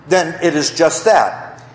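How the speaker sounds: ordinary talk, nothing marked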